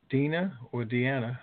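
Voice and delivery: normal talking voice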